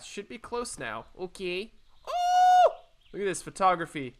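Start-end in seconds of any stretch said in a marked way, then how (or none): 2.1-2.7 very high, loud "oh"; high squeal. Real, not acted. Player reacting to the game, high squeal.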